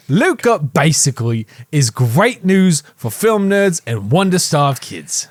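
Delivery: hammed-up British critic, slow and drawn out; slow mock-British review voice; put-on London accent. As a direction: read it in an exaggerated London accent. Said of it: "talks exactly like that".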